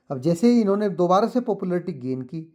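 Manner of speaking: ordinary delivery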